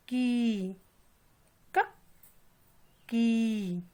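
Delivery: की drawn out with a long ee after a bare क; teacher spelling out क plus ee into की; long ee syllable demo. Shown as a demonstration. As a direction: sound out क, then stretch की. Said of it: "बड़ी की मात्रा".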